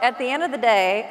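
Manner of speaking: ordinary talk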